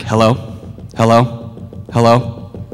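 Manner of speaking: loud, insistent repeated hellos; annoying pestering. Shown as a demonstration. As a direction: keep pestering for attention, loudly. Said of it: "You can't be annoying".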